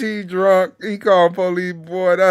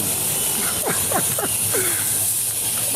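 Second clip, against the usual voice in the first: 1 silent wheezing laugh; 11 semitones higher, 14 dB quieter than usual. Real, not acted. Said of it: "I'm crying tears".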